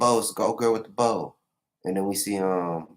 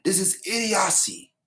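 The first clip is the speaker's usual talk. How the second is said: higher, sneering idiocy; scornful traitor, retry; 11 semitones higher than usual. Retold or imitated. Recapper retrying the traitor's line idiocy, sneering high. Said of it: "is that the way he said it?".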